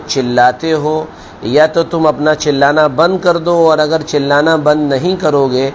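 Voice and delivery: usual speaking voice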